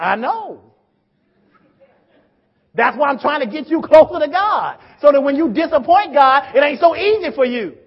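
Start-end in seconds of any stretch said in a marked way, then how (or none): 2.7-7.8 loud, higher-pitched exhortation; impassioned preaching. Real, not acted. Preacher urging congregation closer to God, impassioned and loud. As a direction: drive the point home at full force. Preacher drives point home forcefully.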